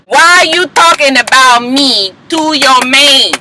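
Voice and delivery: slow, emphatic imitated demand; syllable-by-syllable confrontation. Imitated star confronting her rival, slow beat on each syllable. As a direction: punch out every syllable separately. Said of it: "get them claps on every single syllable".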